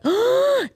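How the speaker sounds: high, drawn-in mock gasp; shocked gasp. Hearing friend's bleeding rumour, high shocked gasp. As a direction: gasp high in put-on horror.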